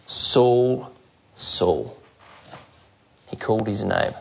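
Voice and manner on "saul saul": voice of Jesus calling. Retold or imitated. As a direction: call the name gently from above. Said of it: "Jesus said those penetrating words".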